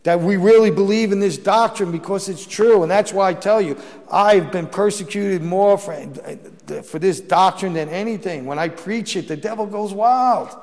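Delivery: impassioned sermon voice; fiery preaching. Acted. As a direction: drive the doctrine home to the congregation. Preacher building to full force.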